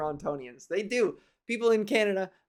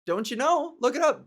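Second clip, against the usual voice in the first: mock smugness; 6 semitones higher than usual. Friend teasing the comedian about the highway, mock smug. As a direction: needle him like the friend did.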